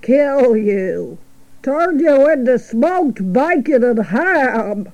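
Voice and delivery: slow, drawling sheep voice; drawn-out sheep delivering grim news; old sheep. Acted. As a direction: spell it out slowly as an old ewe.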